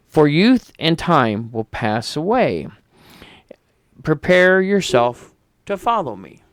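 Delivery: slow, grave recitation; solemn epitaph reading. Acted. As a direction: read it like carved in stone, slowly. Reads epitaph aloud.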